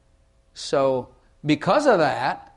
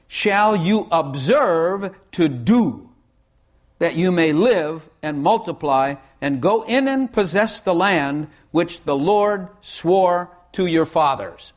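slow solemn scripture reading; slow solemn Bible reading; 2 semitones higher, 4 dB louder, slower than usual. Acted. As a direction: intone the verse slowly.

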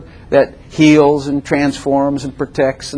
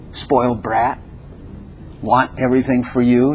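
normal talking voice

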